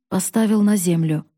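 ordinary delivery, nothing marked

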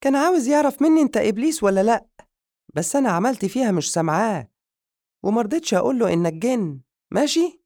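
put-on high, loud and fast gleeful girl voice; gleeful exclaim. Madiha telling the jinn how she fooled the man, gleeful and fast. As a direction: bubble over with delight, rattle it off. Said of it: "فصاحت بالجن بفرحة".

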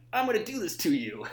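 hammed-up caveman taunt; caveman threat. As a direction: bark it like a posturing caveman. Miming caveman intimidation dance.

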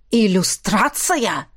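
drawn-out roaring word; dragon roar. Acted. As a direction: bellow it back in outrage. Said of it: "взревел дракон".

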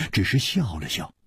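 ordinary delivery, nothing marked

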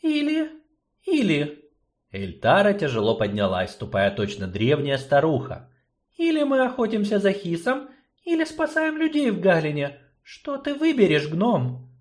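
high, heavy sorceress; tired choice laid out; weary sorceress. Acted. Sorceress puts the choice to gnome.

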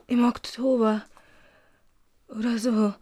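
usual speaking voice

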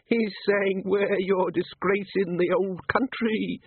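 put-on menacing drunk from the old country, slow; Uberwald bogeyman. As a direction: bogeyman leering at Angua, slow and high. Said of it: "Schlitzen taunting Angua".

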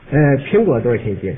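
low-pitched customer placing an order; polite customer. Acted. Customer buying fruit from a surly clerk, low and polite.

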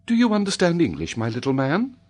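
high, lilting lady's question; kindly young lady. A narrator's reading. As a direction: ask a child in a high lady's voice. Lady questions the native child.

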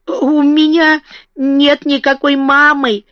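put-on high, slow childish wailing; strained, drawn-out cry; forced wail. A narrator's reading. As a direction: drag it out like a child's half-hearted howl. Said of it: "первый вопль мисс Лек вышел довольно натянутый".

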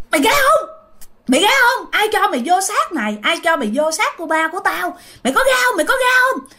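furious high shout at the possessing ghost. Retold or imitated. Aunt confronting the ghost.

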